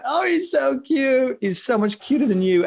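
high, drawn-out gushing over a cute pet; aww-so-cute squeal; delighted cooing. Real, not acted. Gushing over a cat on camera.

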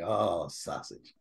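low, slow, dreamy longing; craving sausage. Retold or imitated. Speaker recalling driving near Good, low and slow with longing. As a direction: savor the thought of the sausage, drawn out.

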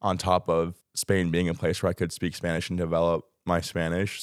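normal talking voice

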